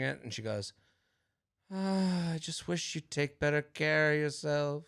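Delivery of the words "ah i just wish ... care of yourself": sighing mom. Retold or imitated. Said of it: "sigh to the heavens".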